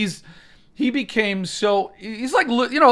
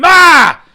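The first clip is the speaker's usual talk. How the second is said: a short, loud, high scream; demo scream; 12 semitones higher, 14 dB louder than usual. Shown as a demonstration. Showing viewers how to use The Scream Hole, screaming. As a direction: scream into the abyss. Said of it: "you just scream".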